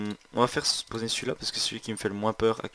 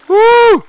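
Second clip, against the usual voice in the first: high excited whoop; 19 dB louder than usual. Real, not acted. Boss appears, cheering.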